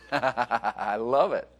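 chuckling, slowed right down; amused slow chuckle; laughing. Real, not acted. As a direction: laugh through the words. Joking about wife bowing.

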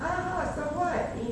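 high-pitched mock-gloating ha-ha; boasting. Shown as a demonstration. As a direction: brush it off in a high, gleeful voice. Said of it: "I boast in it".

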